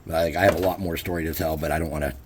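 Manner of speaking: usual speaking voice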